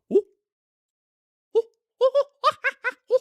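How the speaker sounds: high, loud monkey hoots with a laugh; monkey noises. Retold or imitated. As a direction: hoot like an excited chimp. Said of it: "It is literally monkey going".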